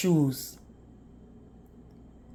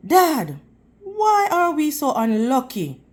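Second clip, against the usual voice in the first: blurted out; 8 semitones higher than usual. A narrator's reading. Boy blurting worry to his father, urgent. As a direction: let it burst out of the boy. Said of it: "he blurted out".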